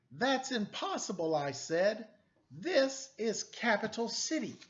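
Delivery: animated picture book reading; story narration. A narrator's reading. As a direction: read it like a noir storybook to kids. Reads picture book aloud.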